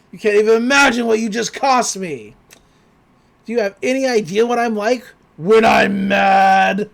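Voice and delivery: loud, raging villain voice; mock furious demon. Retelling Bill raging at Gideon, loud mock fury. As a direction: blow up at them in a rage.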